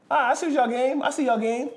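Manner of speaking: high, sly sing-song taunt; mocking, I see what you did there; knowing taunt. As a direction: friend caught onto the prank, high sing-song teasing. Friend catches on to prank.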